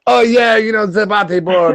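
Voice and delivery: high, hammed-up fake European accent. Fooling tourists at Venice Beach with a fake European accent. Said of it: "you throw on a fake accent".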